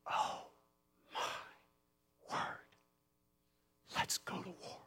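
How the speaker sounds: hushed, astonished whisper; awed whisper. Acted. Servant seeing the army of angels, awed whisper. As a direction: whisper it in stunned wonder.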